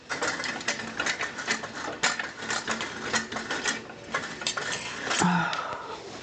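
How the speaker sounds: long weary unvoiced sigh. Real, not acted. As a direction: let out a long tired breath. Struggling with fading light.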